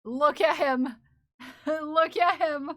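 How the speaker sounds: loud, giggling look at him; delighted laughing exclamation. Real, not acted. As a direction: gush through laughter over the emote. Delighted at new emote.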